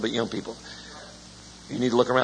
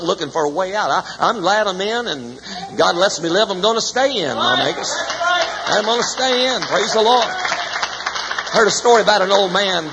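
preaching at full force; 8 semitones higher, 4 dB louder than usual. Acted. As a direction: declare it proudly to the church. Preaching climax, stay in.

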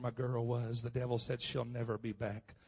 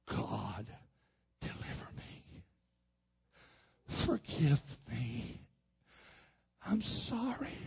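crying out, retold; 3 dB quieter, slower than usual. Father fallen on his face before God, tearfully crying out. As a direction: act out a slow, tearful prayer for mercy. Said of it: "began to scream and cry out".

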